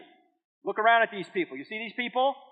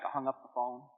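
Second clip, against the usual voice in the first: ordinary delivery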